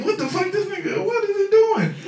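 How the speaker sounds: high whining disbelief; mock whine. Comic baffled by his brother's joint, high whine. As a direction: squeal it out, baffled.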